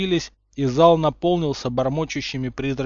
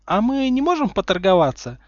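cutesy pleading; 7 semitones higher, 5 dB louder than usual. A narrator's reading. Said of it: "умильно хлопая глазами".

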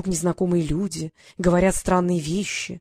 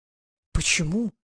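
astonished; slower than usual. A narrator's reading. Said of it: "изумилась Василиса".

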